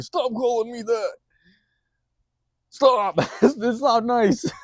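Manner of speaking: mock whiny yell. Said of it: "He was, like, yelling".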